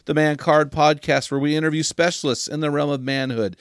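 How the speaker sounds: rally the listeners like an announcer; podcast host opener; show intro read. Acted. Host reads show welcome.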